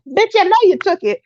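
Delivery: quick, hammed-up accusing voice; mock accusation. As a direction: play the man snapping at his doll. Imagined man accusing the doll.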